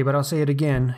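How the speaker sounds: normal talking voice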